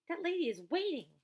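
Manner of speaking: loud, high hurried plea; anxious boy rushing friends; urgent. A narrator's reading. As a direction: hurry them up. Dink urging Josh to hurry.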